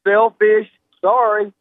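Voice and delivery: yelling over helicopter. Real, not acted. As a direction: push over the rotor noise. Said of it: "Sorry about the helicopter".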